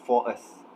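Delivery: "for us" with no r sound between the words; "for us" without the intrusive r; no linking r. Shown as a demonstration. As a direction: say "for us" keeping the two words separate, r dropped. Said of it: "produced the sound as".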